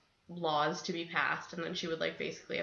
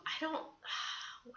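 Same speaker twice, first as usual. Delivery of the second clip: ordinary talk, nothing marked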